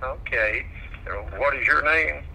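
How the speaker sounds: usual speaking voice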